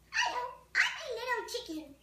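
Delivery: high, squeaky cartoon chick voice; little chicken voice. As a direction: play a tiny chicken saying hello. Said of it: "That's what I would sound like if I was a chicken".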